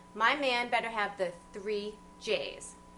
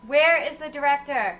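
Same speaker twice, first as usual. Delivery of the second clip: louder, calling out for help; loud demand; 3 semitones higher, 11 dB louder than usual. Acted. Fed-up woman calling out for the show's director. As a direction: yell for the director over him. Date demands to leave the show.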